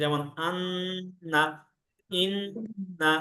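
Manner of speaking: আন্না and ইন্না drawn out very slowly with a held nasal ন্ন; nasal ghunna. Shown as a demonstration. Teacher showing ghunna to parents, very slow held nasal. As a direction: hum the ন্ন in আন্না, ইন্না long through the nose. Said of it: "নাকের বাসি থেকে".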